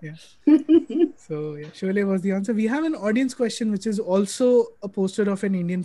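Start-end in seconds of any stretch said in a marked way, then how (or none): 0.4-1.1 short high laugh; burst of laughter. Real, not acted. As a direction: laugh out loud.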